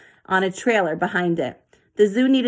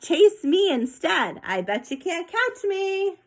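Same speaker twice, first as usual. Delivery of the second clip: playful teasing; 9 semitones higher, slower than usual. Acted. Zoe teasing baby elephant.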